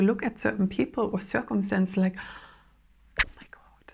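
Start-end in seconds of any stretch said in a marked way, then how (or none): 3.2-3.8 a hushed whisper of sudden realisation; awed whisper. Acted. Imagined person seeing clearly, whispering in awe. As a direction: breathe it as if stunned.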